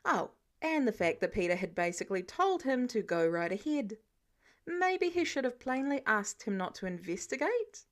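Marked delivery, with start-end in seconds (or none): none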